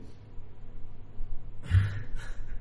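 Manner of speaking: a low, breathy burst of laughter; the pastor laughing as he tells of the Christmas hampers, a deep laugh. Real, not acted.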